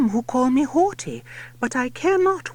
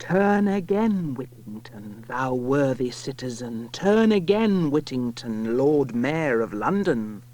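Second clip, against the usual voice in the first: booming bells; 10 semitones lower than usual. A narrator's reading. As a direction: proclaim like church bells tolling. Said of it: "He heard the bells of Bow proclaim".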